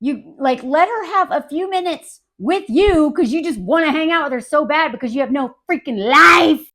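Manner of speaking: normal talking voice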